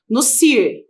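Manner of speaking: "cir" said on its own, crisp and clear; syllable named. Shown as a demonstration. Teacher naming the stressed syllable to students, crisp. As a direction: isolate the syllable "cir" for the class. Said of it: "o que que você vai".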